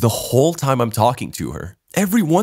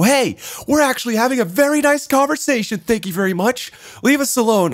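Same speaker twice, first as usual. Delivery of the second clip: much higher, prim woman's voice; mock prissy woman; 10 semitones higher than usual. Mimicking the woman telling his friend to leave, high and prim. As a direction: play her brushing the friend off, all fake politeness.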